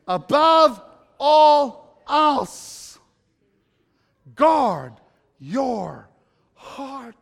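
"above all else, guard your heart" stretched very slow and loud; each word of "above all else" pulled long, then "guard your heart" set apart by pauses; said with me slowly. Shown as a demonstration. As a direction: lead the crowd in "above all else", drawn out. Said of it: "say it with me".

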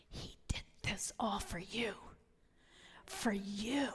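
very soft, breath-only hush; tender whisper. Retold or imitated. Friend showing her God's creation, tender whisper. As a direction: whisper it as a gentle revelation.

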